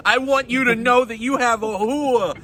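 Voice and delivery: high, prim caller reporting a neighbor; mock complaint call.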